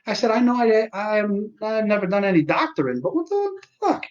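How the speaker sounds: sarcastic patient venting at the doctor; exasperated rant. Retold or imitated. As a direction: push it up high in disbelief. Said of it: "I'm like".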